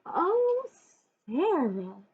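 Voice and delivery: high, swooping gush. Acted. Principal greeting Sarah at the car, gushing. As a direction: gush over her. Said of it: "Missus Burton gushed".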